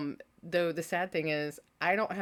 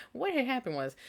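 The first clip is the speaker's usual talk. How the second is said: playful catchphrase; 8 semitones higher than usual. Shown as a demonstration.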